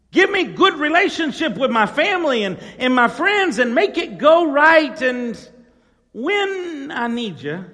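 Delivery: preacher mimicking selfish believers telling God what to do, loudly; mock demanding prayer. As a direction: ham up a greedy prayer.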